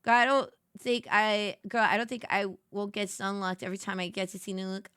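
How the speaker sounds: usual speaking voice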